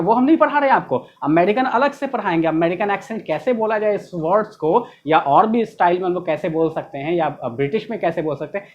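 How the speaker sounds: normal talking voice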